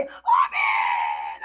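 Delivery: long drawn-out amen shout; wide-mouthed amen. Retold or imitated. Retelling a joyful reply in a vision, long shouted amen. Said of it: "입을 벌리고 있는 아가리 다 벌리고".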